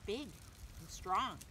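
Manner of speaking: usual speaking voice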